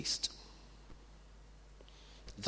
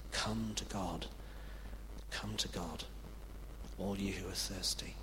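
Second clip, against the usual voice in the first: soft, breathy whispered invitation; hushed whisper; 3 semitones lower, 8 dB quieter than usual. Acted. Preacher whispering the prophet's call to come to God, hushed whisper. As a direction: draw them in with a whisper.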